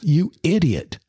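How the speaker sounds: mock-furious boss calling out his employee; a boss chewing out a late worker, half-acted anger; imitating livid boss. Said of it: "he's livid".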